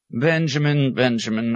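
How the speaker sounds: high appeal, easing on repeat; lightly voiced entreaty; pleading abbot. A narrator's reading. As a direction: call after him pleadingly. Abbot hurries after him, pleading.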